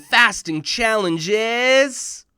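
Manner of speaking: booming prize announcement, rising on is; announcer voice. Acted. Speaker opening his talk, announcing the winner loudly. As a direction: build suspense like a host.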